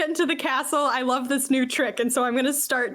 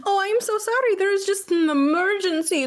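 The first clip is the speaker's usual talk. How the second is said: pitched way up, sweetly apologetic; mock contrite apology; 6 semitones higher than usual. To the scolding steward, a high, sugary apology. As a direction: play the sorry guest, voice raised high.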